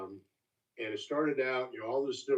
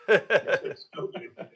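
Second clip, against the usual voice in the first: high, slow, laughing praise; laughing delight; 6 semitones higher, 6 dB louder, much slower than usual. Retold or imitated. Recalling the first sniff, laughing delight. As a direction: savour it through laughter.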